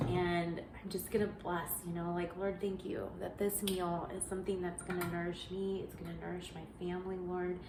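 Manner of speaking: soft, hushed blessing over the meal; murmured grace at the stove; quiet prayer. Acted. Speaker prays a blessing over the meal.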